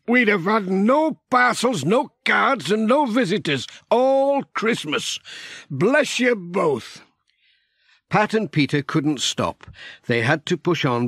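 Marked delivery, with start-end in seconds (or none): 0.1-7.0 put-on grateful old farmer. Narrator voices grateful Alf.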